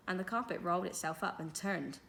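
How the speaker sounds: ordinary delivery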